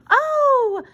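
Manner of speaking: put-on high-pitched, eager child's plea; gasp, then beg in a high little voice; begging. A narrator's reading. Susie asking to come along, high begging. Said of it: "begged Susie".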